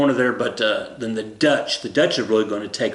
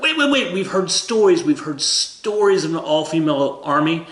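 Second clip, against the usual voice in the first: high, eager burst that settles down; excited interruption; 4 semitones higher than usual. Acted. Europeans interrupting about stories they've heard, excited. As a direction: burst in urgently, starting high.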